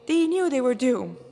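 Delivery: ordinary delivery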